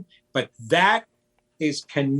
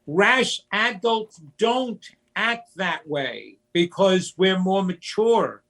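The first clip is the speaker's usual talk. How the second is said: normal talking voice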